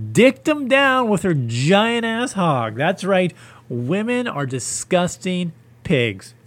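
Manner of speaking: high, slow, drawn-out comic indignation; hammed-up outraged proclamation; mock outrage. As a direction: stretch it out in pretend disgust. Mock-outraged comic rant about women.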